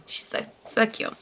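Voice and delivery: whispered, doting coo; soft coo. Real, not acted. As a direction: melt over her, softly. Cooing over the dog.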